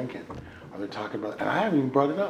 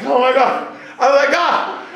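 retold distress; 9 dB louder than usual. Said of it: "I'm just bawling".

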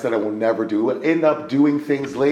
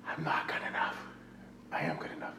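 whispered inner voice; 3 semitones higher, 16 dB quieter than usual. Retold or imitated. Inner voice, repeated list.

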